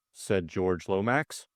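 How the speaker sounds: ordinary delivery, nothing marked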